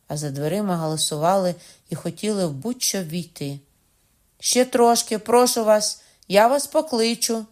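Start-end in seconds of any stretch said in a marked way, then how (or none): 4.4-7.5 raised, urgent pleading through the door; loud begging. Acted. Bride begs the crowd behind the door, loud and pleading. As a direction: call out and beg them to wait. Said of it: "просила я".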